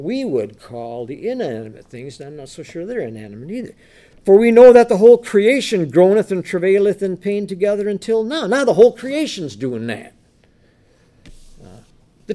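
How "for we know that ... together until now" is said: loud, raised preacher's reading voice; scripture reading. Acted. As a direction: proclaim the verse with force. Reads scripture aloud.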